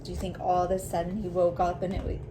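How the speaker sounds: ordinary talk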